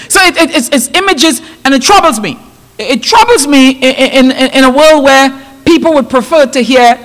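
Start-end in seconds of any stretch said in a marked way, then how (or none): none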